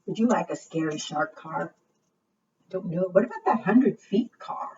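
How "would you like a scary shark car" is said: usual speaking voice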